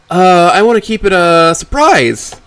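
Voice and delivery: loud, high, slow daughter voice; stall, then announce it loudly; evasive daughter. Acted. Voices Amber covering up awkwardly.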